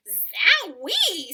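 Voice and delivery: loud startled zowie; exclamation. Acted. Ada hit by a terrible smell, loud startled exclamation. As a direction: blurt it out at the stink. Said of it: "said Ada".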